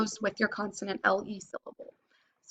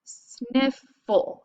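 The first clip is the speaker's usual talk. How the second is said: sniffle drawn out slowly; slow sounding out; 3 semitones higher than usual. Shown as a demonstration. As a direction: stretch out sniffle for the class. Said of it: "we do the break between them".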